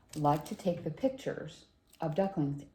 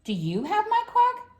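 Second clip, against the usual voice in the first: high-pitched duckling question; eager high baby-duck voice; little duckling; 6 dB louder than usual. A narrator's reading. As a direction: pipe it up, climbing very high. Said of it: "Little Duckling asked the cow".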